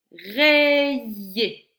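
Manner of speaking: slow, projected syllable demo of "Rayer". Shown as a demonstration. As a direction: draw out both halves of "Rayer". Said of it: "dans quelle syllabe tu entends".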